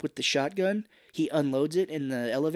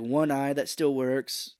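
normal talking voice

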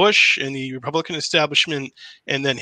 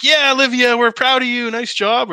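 much higher, louder, gushing fake praise; mock proud parents; 10 semitones higher, 6 dB louder than usual. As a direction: play a sugary proud family cheering. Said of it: "they're not like".

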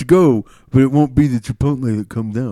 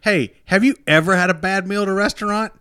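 ranting customer; 8 semitones higher, 4 dB louder than usual. Pretend. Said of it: "You said, you should be like".

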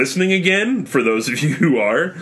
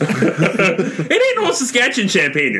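slow, laughing hint of Canadian drawl; mock Canadian accent; 4 semitones higher, slower than usual. Host mocking Old Milwaukee, slow laughing Canadian accent. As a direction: lean into a bad Canadian accent while laughing. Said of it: "shitty Canadian accents".

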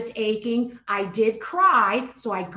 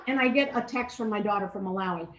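ordinary talk

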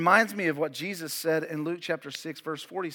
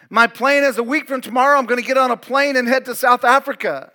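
loud, raised-pitch preacher delivery; full-force preaching; 7 semitones higher, 14 dB louder than usual. Real, not acted. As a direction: preach it hard, driving each point out to the congregation.

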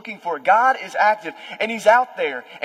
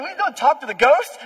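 high, laughing through the words; mock scolding with a laugh; 8 semitones higher, 7 dB louder than usual. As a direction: tease him while cracking up. Disciples scold Peter, laughing.

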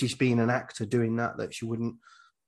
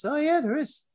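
sudden high surprised yelp; surprised realisation. Retold or imitated. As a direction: pitch jumps way up in surprise. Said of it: "he goes".

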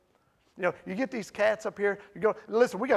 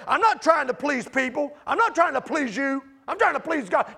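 loud, higher-pitched abrasive declaration; 7 semitones higher, 9 dB louder than usual. Acted. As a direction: say it forcefully, like someone prideful and hard. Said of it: "this sounds really abrasive".

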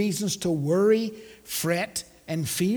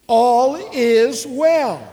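loud, higher, drawn-out declaration; emphatic preacher rallying the congregation; with conviction; 5 semitones higher, 8 dB louder, much slower than usual. Shown as a demonstration. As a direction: say it like you truly believe it. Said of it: "Say it like you believe it".

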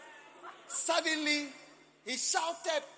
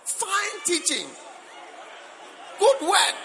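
slow, high, loud praise shout; 12 semitones higher, 5 dB louder, slower than usual. Shown as a demonstration. Preacher mocking the sleeper's outburst, slow loud shout. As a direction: draw each word out at the top of the voice, laughing at the end. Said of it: "You shout".

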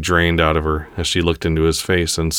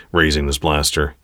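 ordinary delivery, nothing marked

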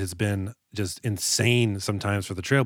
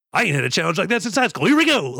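fast, high mock excitement building louder; imitating eager sheriff; 7 semitones higher, 4 dB louder, faster than usual. Sheriff taking the bait from Cutter, hammed-up fired-up excitement. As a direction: rush in, rising to a whooping finish. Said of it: "taking the bait like a bait hungry".

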